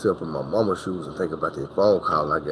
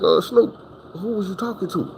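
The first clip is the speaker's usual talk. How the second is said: grandma asking; 11 semitones higher than usual. Retold or imitated. Grandma asking who he talked to, high wary voice. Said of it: "She was like".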